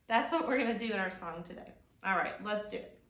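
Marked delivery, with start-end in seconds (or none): none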